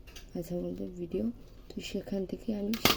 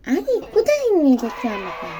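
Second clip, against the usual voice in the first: put-on child god voice; 7 semitones higher, 9 dB louder than usual. Gopal voiced being moved.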